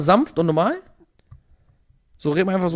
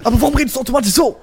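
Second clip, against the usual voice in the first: high, loud, mock-agitated; imitating an overexcited delivery; aufgebracht nachgeäfft; 8 semitones higher, 7 dB louder than usual. Mocks the friend's overdone take. (laut und hoch nachgeäfft)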